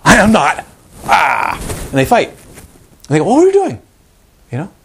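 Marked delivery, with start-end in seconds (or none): 0.0-1.6 higher, louder, indignant snap back; indignant retort. Retold or imitated. Partner snaps back during a couples fight, indignant. 3.2-3.8 a much higher, louder accusing cry; accusing shout. Retold or imitated. Partner yells an accusation mid-fight, shrill and loud.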